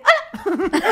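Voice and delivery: high, loud retold yell of hello. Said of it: "le grité así de". (grito agudo de saludo)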